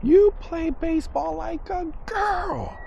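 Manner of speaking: very high, slow mock scream; drawn-out high-pitched taunting yell; retold scream. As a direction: imitate a kid screaming the ultimate insult. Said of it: "he screams".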